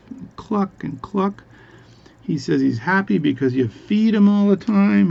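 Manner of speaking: high, excited joke voice; eager tall-tale teller. Acted. Guy eagerly reports chicken's happiness.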